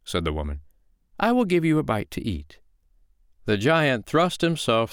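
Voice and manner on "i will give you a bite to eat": very high, singsong invitation; high storybook woman's voice; unruffled foster mother. Acted. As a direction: pipe it up sweetly and politely. Foster mother offers food.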